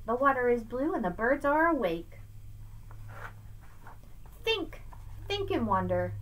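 lively sing-song picture-book read; reading to children. Acted. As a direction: read Seuss aloud to a child, bouncing the rhymes. Reads Dr. Seuss aloud.

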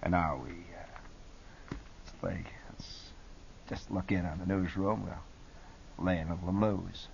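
low, soft, halting late-night voice; laid-back jazz host, barely audible in places; absolutely cool deejay. Shown as a demonstration. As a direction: pause lazily between hushed phrases. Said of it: "I would be absolutely cool".

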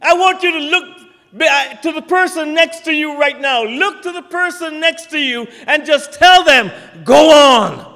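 loud, high exhortation to the pews; rally them to turn and speak; preaching call. Acted. Preacher urging the congregation to tell their neighbour, loudly.

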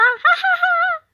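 높고 큰 비웃는 웃음; 친구를 놀리며 크게 깔깔; mocking laugh. 친구들이 비웃음. (친구를 비웃는 높은 웃음)

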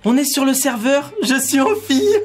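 high, cheering let's go; excited. Real, not acted. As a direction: celebrate getting in. Gets onto the server.

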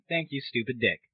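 normal talking voice

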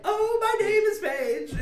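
high, slow mock British accent. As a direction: mimic Paige in a put-on British voice. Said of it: "Please do your page accent".

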